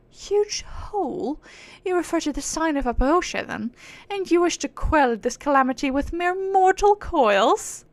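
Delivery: grand, indignant aristocratic British; British accent. Acted. Proud being declaring her sacred duty, haughty British accent. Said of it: "she's acting all high and mighty".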